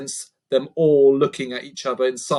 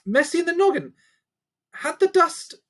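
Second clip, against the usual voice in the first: much higher, puzzled repeat; confused. Acted. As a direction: echo the odd phrase in disbelief.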